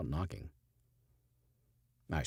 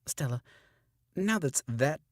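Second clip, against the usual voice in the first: retaken line; 9 semitones higher, 3 dB louder than usual. Shown as a demonstration. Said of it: "it's frustrating sometimes".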